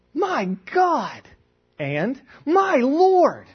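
high mock shout of shock; startled exclamation. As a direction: cry out as if walking in on a fight. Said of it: "And I'm startled by this".

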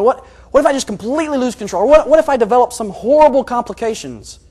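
loud, high, fast what-ifs; spiralling worry. Acted. Preacher voicing his thoughts about diabetes, spiralling worry. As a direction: let anxious thoughts tumble out, rising. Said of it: "Here's how my thoughts often go".

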